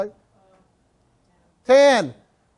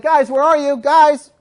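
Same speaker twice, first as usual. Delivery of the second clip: loud worried call for the dogs; 4 semitones higher, 6 dB louder, slower than usual. Retold or imitated. Calling for missing dogs.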